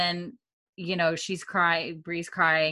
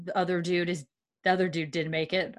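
normal talking voice

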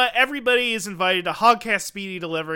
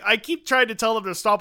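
usual speaking voice

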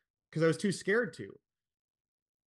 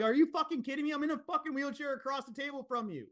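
much higher, an exasperated outburst; incredulous retort; 11 semitones higher than usual. Retold or imitated. Son in wheelchair firing back at his dad, exasperated outburst. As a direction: throw it back in disbelief, voice shooting up.

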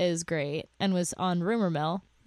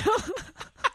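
high laugh; 13 semitones higher than usual. Real, not acted. Laughs at her own joke.

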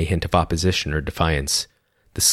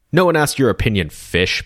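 belted insult; 6 semitones higher than usual. A narrator's reading. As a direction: hurl it across the room. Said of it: "belted a man".

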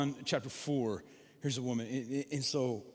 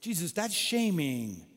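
louder, higher playful protest; mock scolding; 5 semitones higher, 6 dB louder than usual. Real, not acted. Preacher jokingly rebuking Jesus for shaming her, louder and higher. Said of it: "I took classes in psychology".

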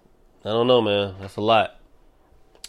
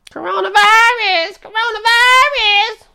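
squealed sing-song shriek, far higher; 3 dB louder than usual. Retold or imitated. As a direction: shriek it like the viral clip. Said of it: "where she's screaming".

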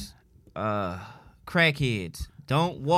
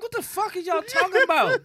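much higher, put-on outraged question; exaggerated confused retort; 14 semitones higher than usual. Him answering the angry crowd, high and baffled. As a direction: play up the bafflement.

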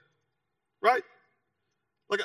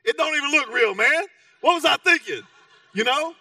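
higher and louder, disbelief at his own mistake; incredulous outburst; 9 semitones higher, 6 dB louder than usual. Real, not acted. Pastor showing the congregation the fake ring, higher and louder disbelief. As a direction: burst out in amazed self-mockery.

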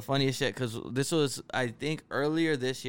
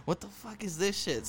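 high, disbelieving exclamation; baffled; 9 semitones higher, 3 dB quieter than usual. Retold or imitated. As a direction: sound totally confused by it. Retelling a baffling dream.

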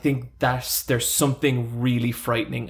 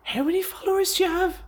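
high quick bright-voiced hairdresser, lightly mocked; imitating hairdresser Jade; faster than usual. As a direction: chirp it like a chatty hairdresser. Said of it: "she's always like".

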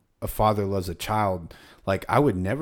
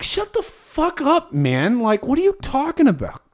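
much higher, slowed mock outrage; mock exasperated Lord; 5 dB louder than usual. Voicing the Lord scolding the teacher, high mock outrage. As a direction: play God fed up with the teacher.

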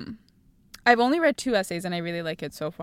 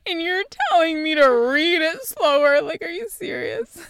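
high, laughing mock outrage; incredulous protest; 8 semitones higher, 8 dB louder than usual. Student told by teacher to reread, hammed-up disbelief. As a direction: act affronted, half laughing.

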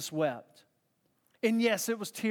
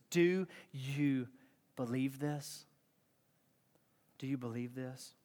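low and soft, with pauses; quiet, searching question; 6 semitones lower, 6 dB quieter than usual. Retold or imitated. Jesus asks Martha a question, quiet and searching. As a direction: ask it low, softening as it repeats.

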